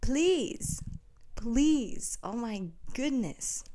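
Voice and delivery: high, loud exasperated plea; fed-up outburst, easing off at the end. Real, not acted. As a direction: draw out each "please", pitched high in annoyance. Exasperated rant at nationalistic Americans.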